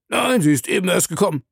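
a dutiful officer announcing a visitor; night-duty officer. Acted. Officer answers superior's question.

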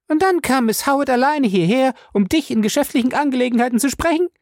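hoch, schnell und spöttisch; höhnisch nachfragend; ironisch. A narrator's reading. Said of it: "fragte sie ironisch". (ironische, hohe Frauenstimme, schnell)

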